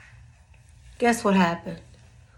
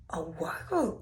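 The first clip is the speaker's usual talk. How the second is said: whispered word; 11 dB quieter than usual. Retold or imitated.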